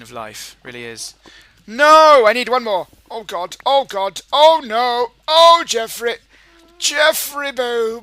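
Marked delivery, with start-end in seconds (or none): 1.7-8.0 high, loud panicked outburst. Real, not acted. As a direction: cry out in alarm at sudden danger. Panicking at danger in the game.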